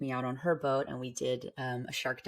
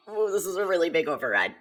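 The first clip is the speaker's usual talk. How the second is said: loud, raised, comic outburst; mock alarm; 4 semitones higher, 11 dB louder than usual. Diver talking herself through panic, mock alarm. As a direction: blurt it out loudly, playing up the overwhelm. Retold panicked self-talk, played for laughs.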